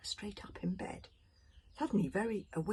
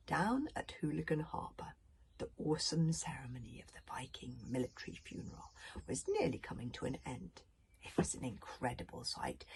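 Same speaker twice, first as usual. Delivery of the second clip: soft, solemn storytelling; hushed narration; 5 dB quieter than usual. A narrator's reading. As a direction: set a solemn night scene quietly.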